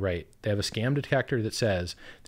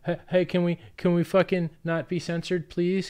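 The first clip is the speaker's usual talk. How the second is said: higher, whiny pretend begging; mock pleading; 7 semitones higher than usual. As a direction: beg the gatekeepers in a sarcastic plea. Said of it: "mock begging the platforms".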